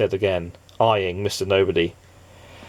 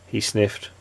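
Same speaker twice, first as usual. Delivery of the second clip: usual speaking voice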